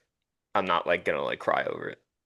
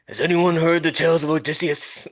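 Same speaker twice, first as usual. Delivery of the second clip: put-on Brooklyn accent; 6 semitones higher, 4 dB louder than usual. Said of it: "Can we hear your Brooklyn accent right".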